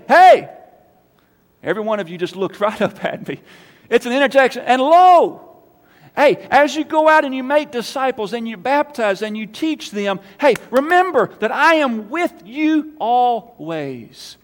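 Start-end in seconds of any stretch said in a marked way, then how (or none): 0.0-0.5 sudden loud high shout to grab attention. Shown as a demonstration. Preacher shouts to jolt the congregation, loud. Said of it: "it'd be like me saying". 3.9-14.3 high, loud, fervent preaching, slowing on the promise; full-force preaching. Acted. Pastor urges the church to remember Jesus, loud preaching.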